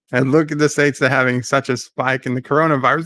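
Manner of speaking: ordinary delivery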